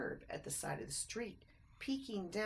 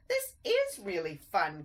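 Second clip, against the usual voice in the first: excited cry; 7 dB louder than usual. A narrator's reading. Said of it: "cried Christopher".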